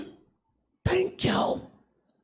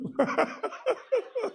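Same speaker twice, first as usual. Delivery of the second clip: high wheezing laughter; real laughing fit; 10 semitones higher, 4 dB quieter than usual. Real, not acted. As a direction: crack up helplessly. Cracking up after singing.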